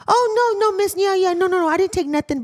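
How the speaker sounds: very high, panicky denial; mock flustered inmate. Inmate panicking at nurse, very high and flustered. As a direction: squeak out the denial.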